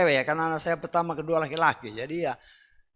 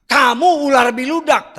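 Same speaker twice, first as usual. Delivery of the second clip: "Kamu ular biludak" loud and high, harsh; harsh quoted rebuke; 7 semitones higher, 10 dB louder than usual. Acted. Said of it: "Apa nggak kasar". ("Kamu ular biludak" diucapkan keras dan tinggi sebagai teguran)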